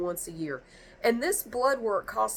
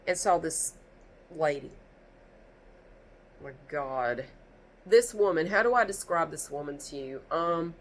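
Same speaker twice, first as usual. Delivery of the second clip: ordinary talk, nothing marked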